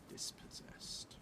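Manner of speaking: usual speaking voice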